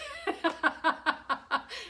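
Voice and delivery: high loud laughter. Real, not acted. Laughing at her own Publix joke. Laugh after mock line.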